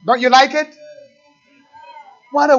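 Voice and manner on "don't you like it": ordinary delivery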